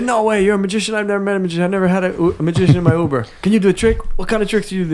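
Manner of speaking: put-on high, loud excited Indian accent. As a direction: gush like an amazed Uber driver with an Indian accent. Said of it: "did the Indian accent".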